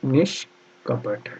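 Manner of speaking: very slow, soft निष्कपट, nish... kapat; quiet spelling-out with a mid-word pause; syllable-by-syllable dictation. Shown as a demonstration. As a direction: dictate निष्कपट in two pieces. Teacher dictating spelling slowly.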